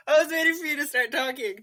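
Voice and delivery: high giggly voice through laughter; laughing. Real, not acted. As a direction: talk while still giggling. Laughing through intro attempt.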